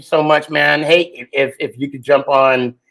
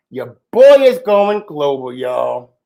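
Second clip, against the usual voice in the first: usual speaking voice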